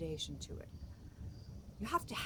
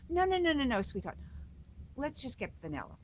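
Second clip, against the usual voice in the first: louder, higher string of refusals settling down; dismissive mom; 7 semitones higher, 5 dB louder, faster than usual. Acted. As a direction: cut the child off, then coax. Mom cuts off the pleading child.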